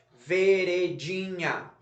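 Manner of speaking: "Veredinha" slowly divided, ve-re-di-nha; syllable by syllable. Shown as a demonstration. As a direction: separate and stretch each syllable of "Veredinha". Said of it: "separar em sílaba". ("Veredinha" separando devagar as sílabas)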